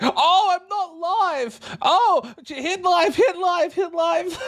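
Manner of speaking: urgently hurrying herself to hit live; panicked realisation. Retold or imitated. Retelling noticing she wasn't live, frantic. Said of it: "I'm like".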